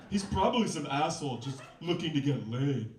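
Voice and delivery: a hint of a jealous, grouchy roommate; Marcy's voice. Roommate grumbling to her friend, lightly voiced jealous grouch. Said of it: "oh, I should do Marcy's voice".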